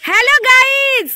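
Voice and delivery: loud, high, drawn-out call into the echo. Real, not acted. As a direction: shout it slowly so it echoes back. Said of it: "ইকো হচ্ছে, ওই জন্য আমরা সবার নাম ধরে ধরে ডাকছি". (প্রতিধ্বনি শুনতে জোরে টেনে ডাকা)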